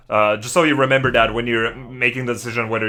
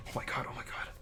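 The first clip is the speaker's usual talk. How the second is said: awed whisper; 20 dB quieter, faster than usual. Real, not acted. Stunned whisper after screaming.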